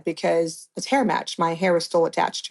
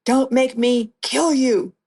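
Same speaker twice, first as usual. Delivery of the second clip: higher and slow, imitating his threat; mock angry yell; 5 semitones higher, much slower than usual. Kidnapper at gunpoint threatening the captive, imitated slow raised-pitch yell. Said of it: "He's yelling at me".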